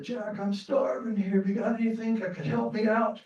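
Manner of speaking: a second hungry head asking Jack for food; starving old man. Acted. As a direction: beg Jack for help.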